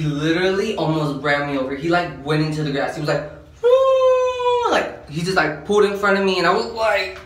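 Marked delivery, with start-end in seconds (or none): 3.6-4.7 squealing high woo; a sharp falsetto woo like a siren chirp; imitated whoop. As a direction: mimic the cop's sudden high whoop. Cop swerving into the grass.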